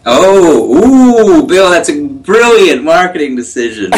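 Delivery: high, swooping admiration; playful gushing. Pretend. Friend praising Bill's slogan idea, playfully thrilled. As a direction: gush over it with long swooping oohs.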